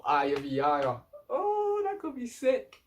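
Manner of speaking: real fan excitement at a dream matchup; hyped up. Real, not acted. Fan hyped about dream fight.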